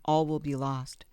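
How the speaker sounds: usual speaking voice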